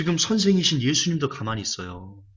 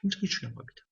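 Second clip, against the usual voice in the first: higher and much softer 굉장히 중요한 겁니다; intense hush; 8 semitones higher, 9 dB quieter, much faster than usual. Shown as a demonstration. Preacher urging the congregation, high hushed emphasis. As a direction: lean in and press it softly, up high. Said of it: "강조할게요".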